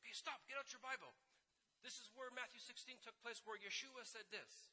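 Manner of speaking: high, urgent tour-guide call; excited guide. Retold or imitated. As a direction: proclaim the site with excitement. Acting excited guide at Bible sites.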